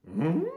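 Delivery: loud puzzled hum rising high; confused Scooby-Doo hum. Acted. Pretending not to understand Shaggy, confused rising hum. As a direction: hum a baffled question, swooping up. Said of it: "pretend that you're confused".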